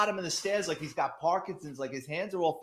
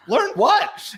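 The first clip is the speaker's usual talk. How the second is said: put-on boyish question; 13 semitones higher, 8 dB louder than usual. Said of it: "And I go".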